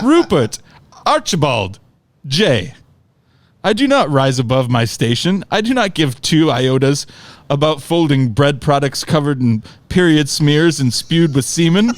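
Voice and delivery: mock-formal London uncle; butchered London accent. Uncle writes back to Corey on Facebook, butchered London accent. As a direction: put on a lofty, bad London accent. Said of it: "I'm sure I will butcher his accent".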